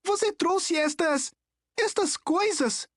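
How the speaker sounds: put-on high, slow, appalled boy's voice; acted high-pitched outcry; shouted accusation. A narrator's reading. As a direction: accuse haltingly in a high, strained voice. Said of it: "gritou Nico".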